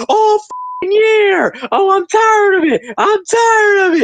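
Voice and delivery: mock screaming rant. Driver screaming over the team radio in a hammed-up rant. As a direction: imitate a driver losing it on the radio, high and wailing. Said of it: "a rant on the radio screaming".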